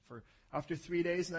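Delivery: ordinary talk, nothing marked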